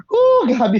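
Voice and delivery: high, loud squeal of joy; excited squeal. Retold or imitated. Reliving the taxi ride, a loud excited squeal. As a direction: squeal like you can't hold it in.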